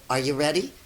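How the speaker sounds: ordinary delivery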